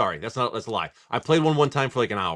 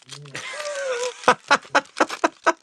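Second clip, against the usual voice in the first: squealing laugh; real high-pitched laugh; high burst of laughter. Real, not acted. Big laugh at chat joke.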